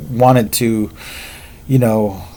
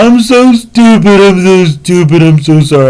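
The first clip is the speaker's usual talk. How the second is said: imitating an apologetic drunk; 7 semitones higher, 6 dB louder than usual. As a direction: plead like a sorry drunk friend. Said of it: "real apologetic".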